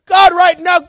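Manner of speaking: shouted, high-pitched prayer; loud revival-style prayer cry; fervent pastor. Retold or imitated. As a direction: imitate a preacher calling down heaven. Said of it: "He sounded like".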